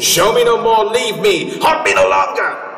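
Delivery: high, loud desperate plea; pleading shout. Acted. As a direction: cry out to make it stop. Scrooge begs the spirit.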